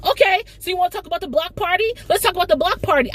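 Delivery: fast, high mock-sarcastic comeback; sarcastic retort. Issa snapping back at Molly, fast and sarcastic. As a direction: rattle it off high and snippy.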